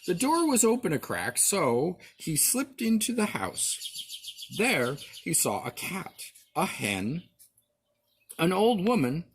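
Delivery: usual speaking voice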